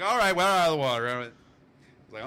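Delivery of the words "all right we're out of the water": high, loud call to get out; hurried exit call. Retold or imitated. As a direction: yell that you're done swimming. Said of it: "And everybody's like".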